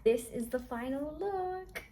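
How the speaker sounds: normal talking voice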